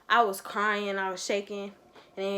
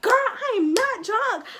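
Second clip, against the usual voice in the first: retold yell; 8 semitones higher, 5 dB louder than usual. Yelling at the woman who won't let her out of the car, furious. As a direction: yell it back like you're being kidnapped. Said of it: "and I just yelled".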